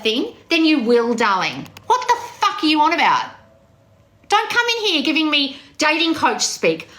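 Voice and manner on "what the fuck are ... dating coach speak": raised-pitch indignant rant; exasperated scolding. Real, not acted. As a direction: tell off the chat member, voice climbing. Telling off a chat member.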